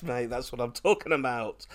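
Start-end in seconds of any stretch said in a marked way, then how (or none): none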